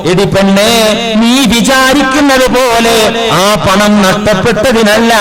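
loud, ringing sermon voice; preaching at full force. Acted. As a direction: proclaim the story to the hall. Husband's answer in full sermon. (ഉച്ചത്തിലുള്ള പ്രസംഗ ശൈലി)

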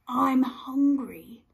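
slow breathy whisper of hunger; mild whimper. Acted. Pirate begging woman to let him in, slow whisper.

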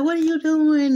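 mock outrage at what he is doing; baffled demand. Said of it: "And she was like".

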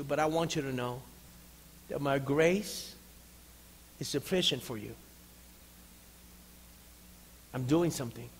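gentle reassurance. Acted. God asking Paul to trust him, gentle. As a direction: reassure quietly, leaving space between phrases. God's tender answer to Paul.

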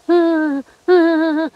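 high, drawn-out groaning whimpers; imitated moaning cry. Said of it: "giống như người ta gên vậy đó".

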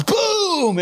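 high, loud boom of a crashing wave; booming imitated crash; explosive impact. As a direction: burst it out like a wipeout. Imitates wave hitting him.

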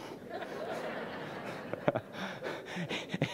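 soft airy unvoiced chuckle; a quiet laugh let out under the breath; breathy laugh. Real, not acted. Preacher recalling the moment, soft airy laugh.

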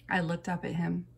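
ordinary delivery

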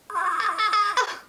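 high, laughing squeal; excited scream. Real, not acted. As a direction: let out an overwhelmed, giggly "ah". Overwhelmed on seeing her video ready.

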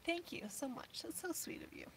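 very soft, nearly whispered thanks; emotional. Real, not acted. Thanking viewers for their kindness, choked to a whisper. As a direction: voice almost gone with real tears. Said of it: "I'm getting all emotional".

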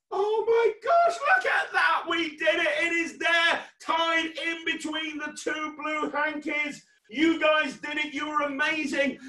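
loud, high-pitched, thrilled shouting; amazed celebration. Real, not acted. Magician celebrates the trick working.

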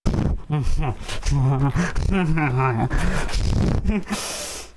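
loose tipsy giggling. Real, not acted. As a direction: laugh like you've had a few. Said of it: "your laugh is what has me more concerned".

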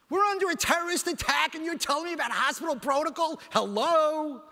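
high-pitched, incredulous protest at the doctor; indignant outburst. Retold or imitated. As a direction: argue back in disbelief, ending on a loud "Hello?". Retold argument with hospital doctor.

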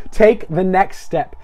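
very slow, deliberate words for repeating; slow call-and-response. Real, not acted. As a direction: lead listeners through it word by word. Leading congregation to repeat line.

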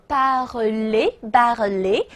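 parler sounded out slowly, syllable by syllable; slow sounding-out. Shown as a demonstration. Teacher sounding out a new verb for students, slow sounding-out. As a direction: draw out parler, ending on a rising "lé". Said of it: "大家自己拼读啊".

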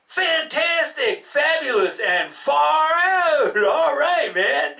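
high, slow, building praise; excited praise. Acted. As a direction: build it up to a shout. Praises dog's letter sound.

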